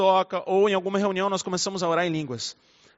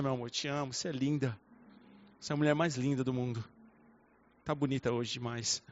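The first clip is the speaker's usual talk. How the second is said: soft, tender and lower, like whispering to your wife; sweet loving voice; 7 semitones lower, 12 dB quieter than usual. Shown as a demonstration. Husband sweet-talking his wife, tender and soft. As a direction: melt into a gentle love declaration, much softer. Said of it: "eu falo com uma voz mais, mais doce".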